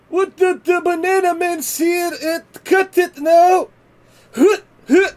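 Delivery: very high, loud hammed-up Greek accent; strong Greek accent. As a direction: shout it in a squeaky Greek accent, slowly. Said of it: "with this strong Greek accent".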